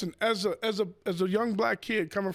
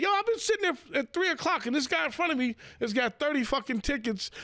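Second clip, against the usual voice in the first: indignant complaint; 7 semitones higher than usual. Retold or imitated. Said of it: "I'd be like".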